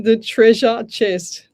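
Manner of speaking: laboured, over-careful "treasure chest"; struggling to pronounce. Real, not acted. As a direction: push "treasure chest" out slowly and forcefully. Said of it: "I'm having issues with speech".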